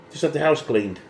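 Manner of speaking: ordinary talk, nothing marked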